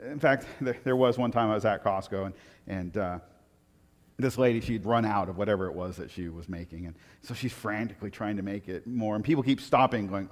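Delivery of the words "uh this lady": ordinary talk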